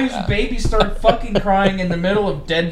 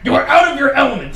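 louder, higher movie-quote yell; mock outburst; 5 semitones higher, 6 dB louder than usual. Friend quotes a film to shut him up, mock shouting. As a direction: yell the line with hammed-up exasperation.

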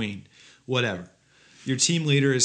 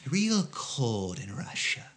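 mock Russian accent; 4 dB quieter, slower than usual.

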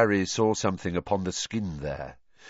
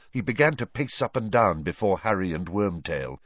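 ordinary delivery, nothing marked

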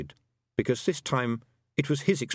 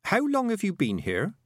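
careful question; 10 semitones higher than usual. A narrator's reading. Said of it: "she asked carefully".

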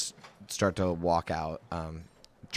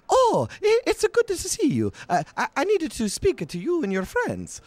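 much higher, flustered, stammering voice; fussy little man; 7 dB louder than usual. Acted. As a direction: squeak your way politely through a crowd. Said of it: "and he'll say".

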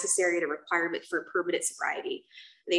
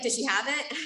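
laughing; 6 semitones higher than usual. Retold or imitated. Joking, laughing.

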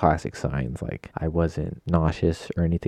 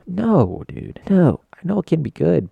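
exasperated refusal; 11 semitones higher, 5 dB louder than usual. Real, not acted. Home cook turning down chicken parm, high exasperated protest. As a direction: refuse it in a high, pleading pitch.

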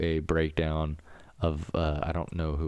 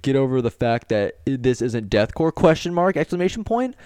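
loud, hammed-up reading of an online comment; mock indignant comment; 4 semitones higher, 7 dB louder than usual. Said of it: "he's like".

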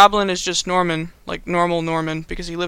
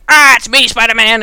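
loud, high villain reveal; hammed-up gotcha yell; Green Goblin; 4 semitones higher, 9 dB louder than usual. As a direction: yell it like a cartoon villain springing a trap. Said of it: "then he's like".

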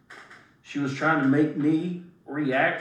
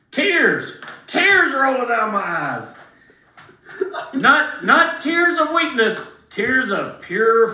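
loud, higher mock-heroic cry of tears; dramatic exclaim; 7 semitones higher, 6 dB louder, slower than usual. Boxer hamming up his nasal swab pain to a friend, loud and mock-heroic. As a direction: ham up the tears like a tragedy.

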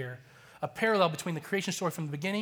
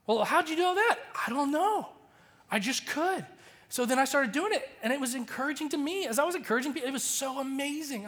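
lightly voiced young boy, higher and eager; a hint of an excited nine-year-old; child's voice; 7 semitones higher than usual. Pastor voicing his son telling about the prayer night, lightly higher and eager.

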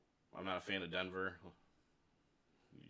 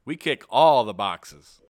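loud slow ad voice; 5 semitones higher, 27 dB louder, much slower than usual. Acted. Mock ad tagline.

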